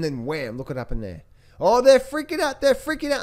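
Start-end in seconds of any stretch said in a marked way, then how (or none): 1.6-3.2 "they're freaking out" squeaked much higher and louder; mock panic. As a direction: ham up the panicking crowd, high and loud. Mocking panicking market crowd.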